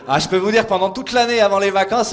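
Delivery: normal talking voice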